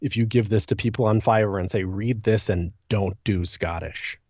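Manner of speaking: normal talking voice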